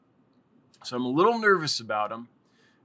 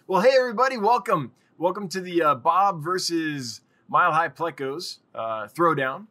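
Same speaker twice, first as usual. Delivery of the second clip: higher, louder ringside announcer; 4 semitones higher, 6 dB louder than usual. Acted. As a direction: hype it like a fight-night host. Hosts a mock showdown.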